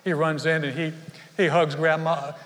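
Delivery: ordinary talk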